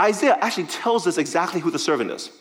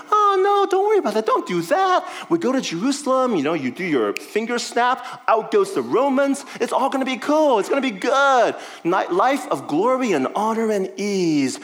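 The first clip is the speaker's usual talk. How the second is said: much higher, louder, hammed-up breezy reassurance; mock Peter; 5 semitones higher, 3 dB louder than usual. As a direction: overdo a carefree friend brushing it off. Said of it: "his closest follower is telling him".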